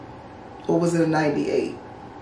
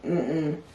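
disapproving hum; 5 semitones lower, 9 dB quieter than usual. Retold or imitated. Disapproving look at him.

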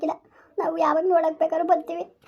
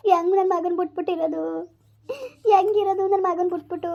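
crying lament. Acted. Parent asking how to live without their son, crying lament. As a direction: wail it helplessly through tears. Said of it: "ಅಳೋದ್ರಲ್ಲಿ ಏನು ಪ್ರಯೋಜನ ಇಲ್ಲ".

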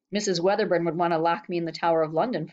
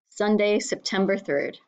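ordinary delivery

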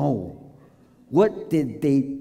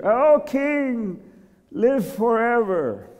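high, long-drawn salute; sing-out reply from afar; calling back; 9 semitones higher, much slower than usual. Acted. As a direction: courtly hail stretched out high. Men call back to the king.

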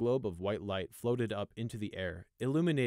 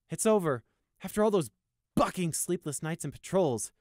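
higher, louder weary soldier; relieved guard; 8 semitones higher, 6 dB louder than usual. Acted. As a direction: let out the relief of long nights. Sunshine character voice.

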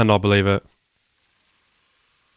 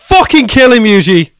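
high, loud, furious pleading; angry shout; 9 semitones higher, 4 dB louder than usual. Real, not acted. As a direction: yell at the screen in real anger. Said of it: "I'm so angry right now".

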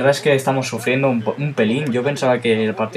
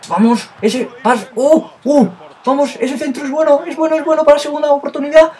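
high, fast excited shouting that keeps climbing; rising excitement; 7 dB louder than usual. Real, not acted. Gamer watching a cross fly in, rising excited shout. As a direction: get more and more wound up as the cross comes in. Excited build-up before a goal.

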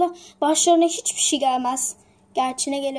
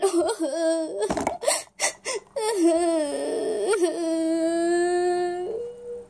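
tearful sob-laugh. Acted. Crying after losing documents.

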